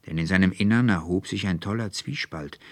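ordinary delivery

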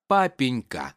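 ordinary delivery, nothing marked

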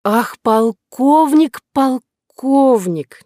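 put-on very slow, high, drunken swoon; unsteady tipsy sigh. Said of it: "неуверенно произнесла Кора".

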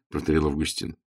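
ordinary delivery

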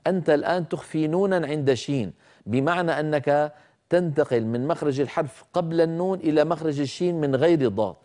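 ordinary delivery

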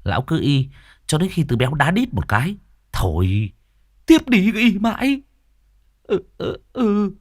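high, slow mock lament with sobs; hammed-up wailing. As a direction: wail over the body like a hired mourner. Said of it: "mếu máo". (khóc mếu máo giả vờ, kéo dài)

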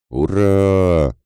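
high, drawn-out cheer; battle cry hurrah. Acted. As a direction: yell it as you charge. Soldiers shout during the charge. (протяжное «ура» в атаке)

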